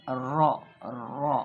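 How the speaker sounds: रॉ with a rolled र; wrong trilled ra. Shown as a demonstration. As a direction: trill the र in रॉ as the mistake. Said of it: "तकरार के साथ". (रॉ तकरार के साथ)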